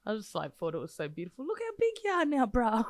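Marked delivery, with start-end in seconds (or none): 1.5-2.8 high, beaming proud-auntie voice. Retold or imitated. Mimicking a mate's proud greeting like an auntie. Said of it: "They sound like proud aunties".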